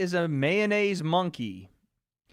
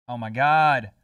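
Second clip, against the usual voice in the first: ordinary talk, nothing marked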